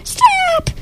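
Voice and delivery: very high troll screams; very high panicked troll stop; trolls screaming. Acted. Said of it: "the trolls screamed".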